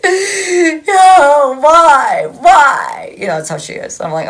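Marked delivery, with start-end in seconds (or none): 0.0-3.1 loud, high hammed-up wail; mock crying. Reader mimicking someone crying on the floor, mock crying. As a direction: wail it out like an overdramatic sobber. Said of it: "he's, like, crying".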